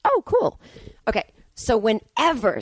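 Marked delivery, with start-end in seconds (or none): 0.0-0.6 mock chirpy. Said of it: "mock reply".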